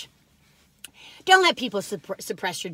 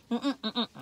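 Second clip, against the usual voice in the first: ordinary delivery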